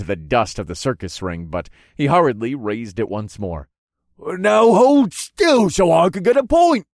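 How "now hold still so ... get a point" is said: high, loud, drawn-out demand; frustrated shout. A narrator's reading. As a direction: yell it as an exasperated fighter. Frustrated opponent orders hero to stay still.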